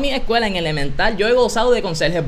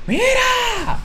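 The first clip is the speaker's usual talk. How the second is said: mock yelling janitor. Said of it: "gritado frente a toda".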